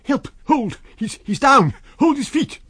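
high, loud desperate yells; frantic shouting. A narrator's reading. Kemp calling the crowd to hold him, frantic yells. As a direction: call for help mid-struggle.

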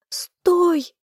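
very high-pitched cry to halt; hysterical scream. A narrator's reading. Heroine screaming at the fog to stop, hysterical scream. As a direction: scream it in panic. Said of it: "закричала я".

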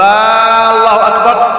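"آه، الله أكبر" high, with a long chanted "aa" before the name; drawn-out opening hamza. Shown as a demonstration. Teacher mimicking the faulty takbir, opening vowel stretched. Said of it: "من مد الهمزة".

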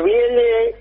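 talking with a pencil between the teeth; slow, stretched words around a pencil; pencil in mouth. Shown as a demonstration. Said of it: "te pones un lápiz en la boca". (hablando con un lápiz en la boca)

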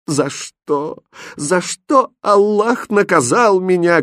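high, slow lament; anguished cry. A narrator's reading. Mother on her knees asking why, anguished cry. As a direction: wail it out, drawing out the grief. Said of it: "кричала она".

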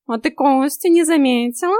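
high, loud, oily cat voice; unctuous singsong. A narrator's reading. Cat needles heroine about bones, unctuous singsong. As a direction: sing the question out with fake sweetness. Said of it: "елейно пропел котяра".

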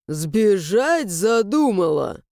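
menacing frown in the voice; frowning stranger. Acted. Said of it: "нахмурился незнакомец".

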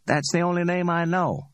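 a hint of cocky Ordell; Ordell's voice. Ordell speaks, voiced higher.

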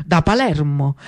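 ordinary delivery, nothing marked

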